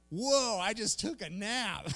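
high, drawn-out amazed whoa; stunned realization. Retold or imitated. Man waking muddled from an afternoon nap, comic amazement. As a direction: come to with exaggerated astonishment.

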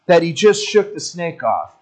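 ordinary talk

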